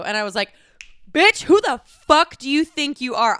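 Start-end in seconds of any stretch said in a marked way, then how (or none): 1.1-3.4 high and loud, furious; furious retold yell. Shouting at the girl who insulted her job. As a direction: yell it right in her face.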